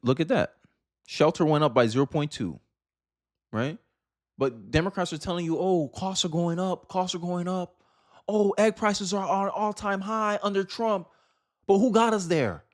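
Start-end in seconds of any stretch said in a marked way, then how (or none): none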